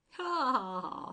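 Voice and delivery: loud, high ha ha ha; hearty laughter. A narrator's reading. Crump laughing along with the puzzled angel. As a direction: burst out laughing at the joke. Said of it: "seized ... by the human disorder of laughter".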